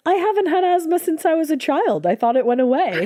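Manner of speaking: high, incredulous protest; indignant disbelief. Retold or imitated. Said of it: "And I said".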